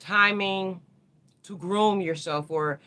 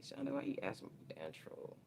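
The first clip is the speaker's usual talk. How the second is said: soft whispered jab; 10 semitones higher, 18 dB quieter than usual. Real, not acted. Teasing a chat troll in a whisper.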